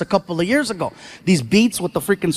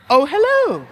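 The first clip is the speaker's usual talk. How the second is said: high, drawn-out hello; mock sweet greeting; 6 semitones higher than usual. Son appearing like Houdini for money, mock high greeting. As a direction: pop up with an exaggerated eager greeting.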